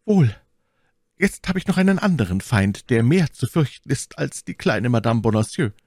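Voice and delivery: high, theatrical Milady voice; high, formal courtly request; scheming lady petitioner. A narrator's reading.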